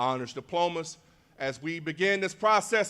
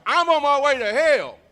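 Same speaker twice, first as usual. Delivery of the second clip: loud, high "I'm on my way to hell" for the crowd; call-and-response shout; 11 semitones higher, 11 dB louder than usual. Shown as a demonstration. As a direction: shout the cue line to get the crowd answering. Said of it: "When I say".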